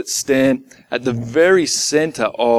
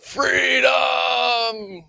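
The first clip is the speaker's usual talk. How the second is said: long, high-pitched battle cry at restrained volume; William Wallace; 12 semitones higher than usual. Retold or imitated. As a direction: imitate a drawn-out film-hero cry, held back from full volume. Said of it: "you remember Braveheart? William Wallace? You know, it's like".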